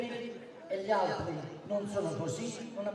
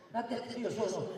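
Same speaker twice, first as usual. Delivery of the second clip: normal talking voice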